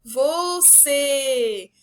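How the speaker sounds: very slow VOCÊ with a long held CÊ; long, sing-out VOCÊ, falling at the end; word called out. Shown as a demonstration. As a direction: call "você" stretched out to show its strong syllable. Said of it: "permaneceu mais no tom da minha voz".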